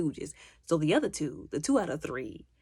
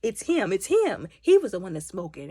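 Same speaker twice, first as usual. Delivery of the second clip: loud imitated blame; emphatic mock tattling; accusing; 2 semitones higher, 8 dB louder than usual. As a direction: pin it on someone else, forcefully. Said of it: "he immediately blames the, the kid".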